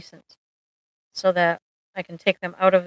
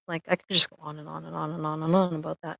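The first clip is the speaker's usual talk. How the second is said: normal talking voice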